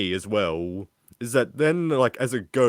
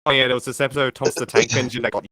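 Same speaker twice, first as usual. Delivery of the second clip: fast, loud Australian mum voice; Aussie accent; 3 semitones higher, 5 dB louder, much faster than usual. Retold or imitated. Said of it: "I love you did Aussie accent".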